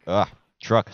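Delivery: hiccup catching the word; almost hiccuping. Real, not acted. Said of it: "Sorry, I'm almost hiccuping".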